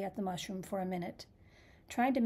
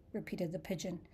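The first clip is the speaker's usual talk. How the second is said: plain reading voice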